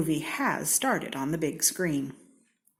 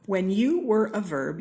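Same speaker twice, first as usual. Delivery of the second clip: usual speaking voice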